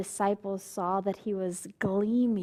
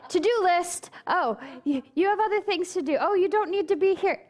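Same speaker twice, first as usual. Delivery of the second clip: much higher, fast nagging inner voice; little demon voice; 8 semitones higher than usual. Retold or imitated. Meditator's inner demon voices distract them, high fast nagging voice. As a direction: rattle it off like a pestering mind demon. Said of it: "those little demon voices".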